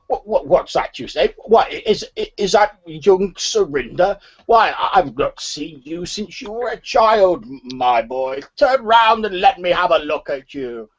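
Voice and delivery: put-on high, wavering pitch on a loud old-man voice. Old man hailing the youngster he knew as a child, high old-man voice. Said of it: "the voice of an old man".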